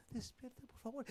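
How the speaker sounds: barely audible, gentle plea; soft wake-up. Shown as a demonstration. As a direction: whisper it as if not to disturb. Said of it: "usted no va a alguien y dice". (despertar en voz muy baja)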